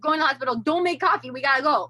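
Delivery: telling husband to skip the coffee, urgently; urgent order. Retold or imitated. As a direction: hurry the husband out the door.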